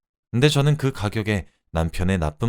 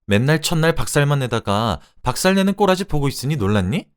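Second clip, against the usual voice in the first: raised, higher-pitched mocking retort; sarcastic taunt; 6 semitones higher, 4 dB louder than usual. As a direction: needle your husband with angry rhetorical questions. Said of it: "라고 비꼬았죠". (언성 높여 비꼬는 말투)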